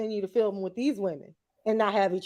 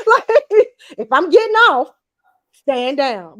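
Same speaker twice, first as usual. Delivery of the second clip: loud high laughing outburst; 3 semitones higher, 9 dB louder, slower than usual. Retold or imitated. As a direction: yell it, laughing. Heated outburst.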